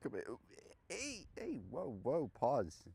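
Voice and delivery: high, squeaky put-on dog voice; French bulldog voice. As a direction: talk like a little French bulldog. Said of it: "That was my French bulldog impression".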